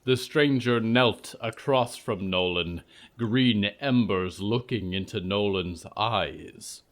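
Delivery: usual speaking voice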